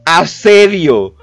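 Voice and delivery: asedio stretched slowly and loudly; stressed word. Shown as a demonstration. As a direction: drag out asedio, heavily mocking.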